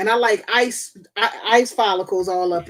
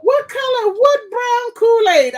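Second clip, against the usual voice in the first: much higher, slow disbelieving question; incredulous; 13 semitones higher, 4 dB louder than usual. Real, not acted. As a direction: stretch out the disbelief. Repeats outraged question.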